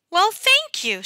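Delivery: high, bright surprised thanks; taken aback by a sudden hug; pleasantly surprised. A narrator's reading.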